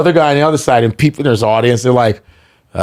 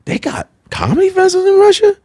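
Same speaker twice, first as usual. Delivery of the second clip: astonished squeak; faster than usual. Retold or imitated. Shocked at Russian festival invite.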